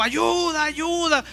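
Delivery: high, stretched cry for help; calling for help. Retold or imitated. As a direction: call out as if nobody can hear. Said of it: "gritó". (grito de ayuda agudo)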